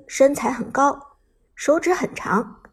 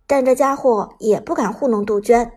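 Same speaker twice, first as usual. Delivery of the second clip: ordinary delivery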